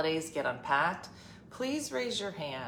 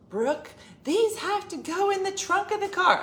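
high, loud bossy daughter; daughter's shrill demand; 7 dB louder than usual. Retold or imitated. Daughter insisting the boxes go in the trunk, high and loud.

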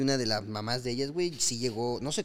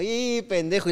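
higher, louder annoyed outburst; mock exasperated yell; 8 semitones higher, 5 dB louder, slower than usual. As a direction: blurt it out in frustration. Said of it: "Y yo así como".

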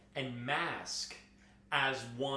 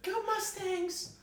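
much higher, slow, goofy fan voice; radio caller; slower than usual. Pretend. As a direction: play an overexcited listener calling in. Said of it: "we got a call from Columbus".

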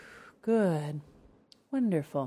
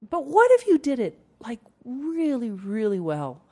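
excited; 5 semitones higher, 4 dB louder than usual. Real, not acted. Rousing the listener.

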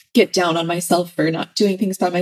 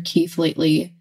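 ordinary delivery

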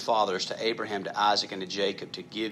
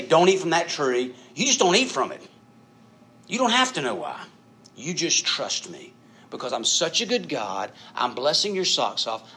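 higher and louder, insistent authority; God's firm command; 5 semitones higher, 4 dB louder than usual. Acted. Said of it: "if I say".

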